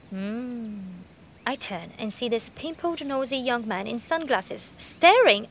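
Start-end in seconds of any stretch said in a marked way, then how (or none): 0.1-0.8 unvoiced, breathy hum; whispered hmm. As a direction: hum it under your breath. A whispered reaction.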